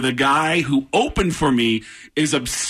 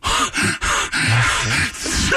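drawn-out hammed-up tearful gasping; mock sobbing gasps; 3 semitones lower than usual. Fan overwhelmed before his sports hero, mock sobbing gasps. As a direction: gasp as if about to bawl. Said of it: "And I started crying".

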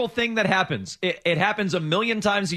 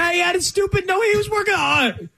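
whiny voice; 13 semitones higher, 4 dB louder than usual. Retold or imitated. Mocking Price's complaint.

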